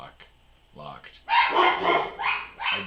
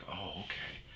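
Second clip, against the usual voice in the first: whispering; 13 dB quieter than usual. Retold or imitated. Whispered retold reply.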